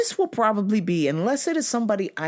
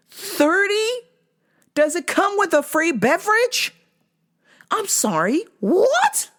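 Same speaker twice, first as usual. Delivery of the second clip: mock disbelief; 14 semitones higher than usual. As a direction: squeal in fake shock. Mock outrage at price.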